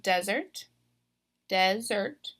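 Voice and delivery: DESert, first syllable stressed; "desert" said DEZ-ert, like the sandy place. Shown as a demonstration. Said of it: "I'm stressing that first syllable".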